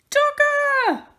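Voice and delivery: high, loud cry of delight; joyful discovery. A narrator's reading. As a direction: burst out with the thrill of finding him. Dave spots his lost toy.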